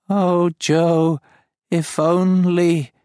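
high, loud, very slow alien sigh; tender dying alien voice; dying creature's longing. Acted. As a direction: let the words fade as it dies. Dying farm speaks.

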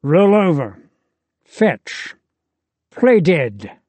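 disdainful. A narrator's reading. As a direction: sneer these like orders to a pet. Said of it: "Azazel says with disdain".

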